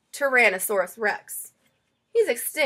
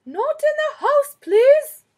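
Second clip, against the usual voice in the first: much higher, louder, slow sing-song reminder; grandma singing out a house rule; Nana's sing-song warning; 14 semitones higher, 10 dB louder, slower than usual. Acted. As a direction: sweetly scold the child, drawn out. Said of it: "Nana's rule to the child".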